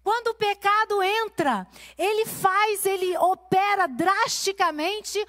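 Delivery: high, pressing preacher's voice; pregação inflamada. Acted. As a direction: preach it out to the women, rising. Opening sermon point, raised voice. (pregação em voz alta e intensa)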